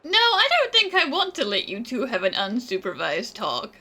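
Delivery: usual speaking voice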